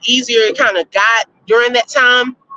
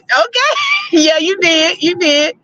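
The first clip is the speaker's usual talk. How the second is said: laughing; 6 semitones higher than usual. Real, not acted. Teases voters, laughing.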